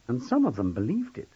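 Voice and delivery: ordinary delivery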